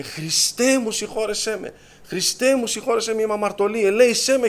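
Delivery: high, sustained pleading imitation; retold crying out. Mother on her knees begging Christ's forgiveness, crying out. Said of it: "Και άρχισε να φωνάζει".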